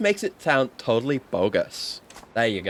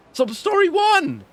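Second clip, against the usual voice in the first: much higher, slow excited yell; squealing hype call; 5 dB louder, slower than usual. Acted. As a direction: shout it like a game show.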